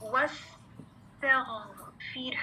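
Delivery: slow, whispered model of वस्तगफिर; whispered slow recitation for students to copy. Shown as a demonstration. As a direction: breathe the word out slowly for the class. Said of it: "दोबारा सुने".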